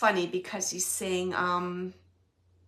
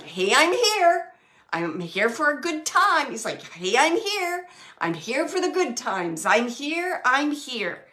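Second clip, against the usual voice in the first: loud, higher cheery greeting; cheerful hey; 5 semitones higher, 7 dB louder than usual. Retold or imitated. Husband's spirit announcing he's here, loud and cheery. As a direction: call out a bright party hello.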